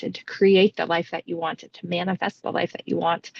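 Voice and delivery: ordinary talk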